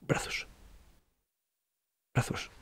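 whispered plea, breath only; whispered prayer asking God to carry him. Retold or imitated. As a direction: beg softly in a bare whisper. Praying to God like the child.

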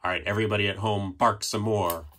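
plain reading voice

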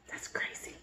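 hushed stage whisper. Acted. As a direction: whisper it like a secret to the kids. Aside to children during story.

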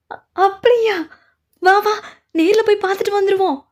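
high, loud eager burst; excited delight. Acted. Hearing the enemy is trapped, excited delight. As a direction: rush in, thrilled at the news.

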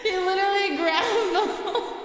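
high, breathless laughter through the words; can barely talk for laughing; laughing hard. Real, not acted. Laughing while the dog grabs her hand.

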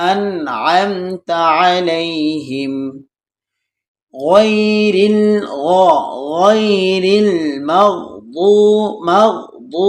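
slow, drawn-out tajweed chant; slow melodic Quran recitation. Shown as a demonstration. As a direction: intone each word slowly. Teacher recites Quran slowly.

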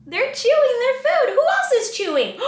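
high loud delighted; laughing eager question; excited. Acted. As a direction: get the kids involved. Excited storytime commentary.

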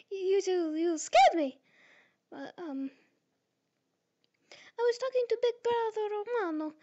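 put-on high, flustered Italian-accented voice, stammering; Italy with Italian accent. Fan does Italy fretting to Germany in an Italian accent. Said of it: "I'm going to try and do Italy's speaking style with the accent".

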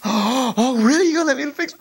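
high, gasping delight; young mechanic can't believe his luck; thrilled boy. Retold or imitated. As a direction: squeal with joy at the chance. Boy thrilled at new task.

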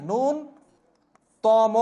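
ন্যূনতম stretched out very slowly; very slow model word. Shown as a demonstration. Teacher models the right form, very slowly. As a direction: draw out every sound of ন্যূনতম. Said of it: "এটা হলো বানান".

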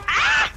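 sudden high yell; scream. Real, not acted. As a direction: shriek as you die. Killed in game.

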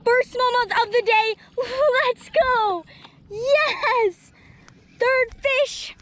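high, loud thrilled cries; excited yelling. Real, not acted. Angler thrilled at landing the first smallmouth, shouting. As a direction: shout it out like you've finally hooked one.

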